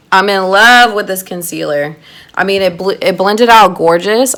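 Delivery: ordinary delivery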